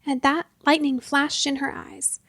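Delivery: usual speaking voice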